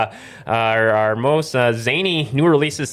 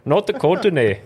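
hammed-up North Dakotan Norwegian lilt; North Dakotan accent; 3 semitones higher, slower than usual. Host owns up to the North Dakotan accent, hammed up. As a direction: play up the North Dakotan long O. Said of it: "my North Dakotan Norwegian coming out".

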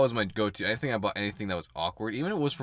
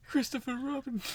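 high, softer cartoon bear voice; Winnie the Pooh; 13 semitones higher, 5 dB quieter than usual. Bear calling Christopher Robin, high impression voice. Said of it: "I'm gonna do my poo impression".

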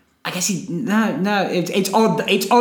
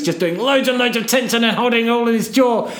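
tense jaw voice; 5 semitones higher than usual. Shown as a demonstration. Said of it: "that's not supposed to be a Woody impression".

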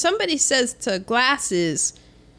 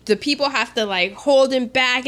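ordinary talk

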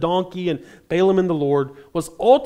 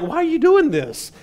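mock indignant; 10 semitones higher than usual. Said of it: "the angel and the donkey turn on Balaam and be like".

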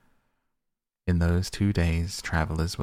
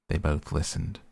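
ordinary delivery

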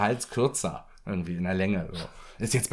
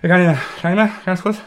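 retold gasping, high and loud; puffing after climbing stairs; out of breath; 7 semitones higher, 8 dB louder, faster than usual. Panting at top of subway stairs.

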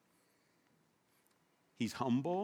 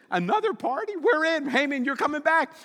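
much higher, loud delighted yes; eager king; 11 semitones higher, 4 dB louder than usual. Acted. King Xerxes accepting Esther's party invite, thrilled.

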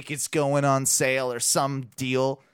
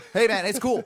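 high-pitched, louder appeasing voice; imitated anxious placating; 8 semitones higher, 5 dB louder than usual. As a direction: act out strangers hurriedly calming someone down. Voices the strangers calming him down.

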